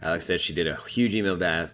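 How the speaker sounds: ordinary delivery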